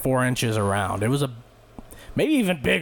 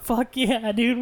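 mock cheer; 10 semitones higher, slower than usual. Narrator cheering Tyler on.

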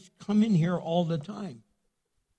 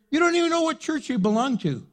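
higher and fast, scolding; fast mocking exclamation; 9 semitones higher, faster than usual. Real, not acted. Preacher teasing church hoppers, fast and high. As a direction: rattle it off in mock disbelief.

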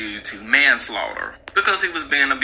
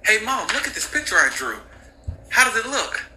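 put-on child voice; 7 semitones higher than usual. Child showing mom a drawing.

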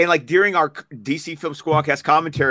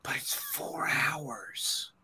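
slow, breathless whisper of disbelief; disbelieving whisper; 16 dB quieter, much slower than usual. Retold or imitated. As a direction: whisper it slowly like you can't believe it. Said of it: "and then I stop and go".

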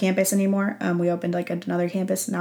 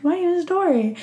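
much higher squeaky reply; imitated reply; 11 semitones higher than usual. Newcomer answering a friendly stranger, much higher. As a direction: answer in a high little voice. Her own shy reply retold.

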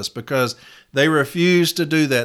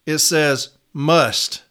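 "must" set apart after a pause, very slow; pause, then land squarely on "must"; stress on must; much slower than usual. Shown as a demonstration. Correcting might and should, stressing "must" slowly and deliberately. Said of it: "It doesn't say might".

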